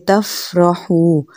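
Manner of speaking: slow demo of फ़ा in तफ़रहू; careful fa. Shown as a demonstration. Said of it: "ऊपर वाले दांत नीचे वाले होंठ से लगेंगे".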